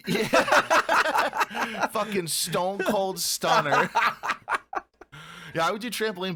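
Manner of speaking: slow words with a laugh in them; laughing while talking. Real, not acted. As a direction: laugh through it. Laughing at wrestling move.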